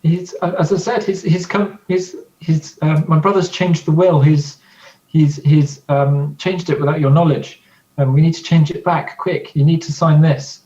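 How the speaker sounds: daughter voice. Acted. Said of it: "you gotta do a voice".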